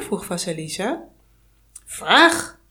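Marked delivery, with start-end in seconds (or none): 1.8-2.6 loud, high barked order; commanding. Acted. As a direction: bark the single word as a command. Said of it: "beval de Yaga". (luid bevelend)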